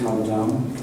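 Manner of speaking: ordinary talk, nothing marked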